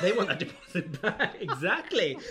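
laughing. Real, not acted. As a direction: giggle while riffing on the song. Laughing through song reference.

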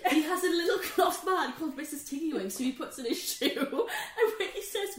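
laughing. Real, not acted. Still laughing retelling.

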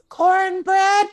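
put-on a five-year-old repeating herself, high and loud; little girl's voice. Woman imitating a five-year-old niece insisting, high and loud. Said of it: "She goes".

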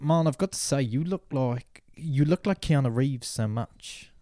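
put-on slow, drawn-out South African accent. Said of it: "you do South African pirate".

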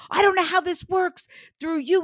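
high, loud mock-frustrated dad; exasperated father. Medium voicing the annoyed father, hammed-up shout. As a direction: play the baffled father, hammed up.

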